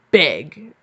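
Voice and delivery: commanding lover, forcefully. A narrator's reading. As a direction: bark the order at her. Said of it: "he said more forcefully this time".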